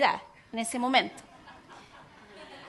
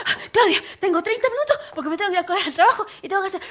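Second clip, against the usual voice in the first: fast, higher rattled-off rush; rushed pastor; 5 semitones higher, 4 dB louder, much faster than usual. Retold or imitated. Husband rushing in to visit, gabbling fast and high. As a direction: play the hurried husband gabbling excuses.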